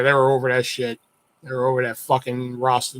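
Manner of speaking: ordinary talk, nothing marked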